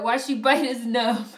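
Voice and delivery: playful repeat of the chat line, stressing "bite"; mock quoting chat. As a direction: tease the question back, punching "bite". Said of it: "Why did you say it like that?".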